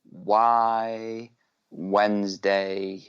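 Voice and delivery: slow, drawn-out whispery call; theatrical title echo. Pretend. Co-host echoing the episode's name, slow theatrical echo. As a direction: stretch both words out like a show ident.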